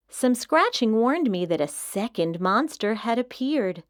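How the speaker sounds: usual speaking voice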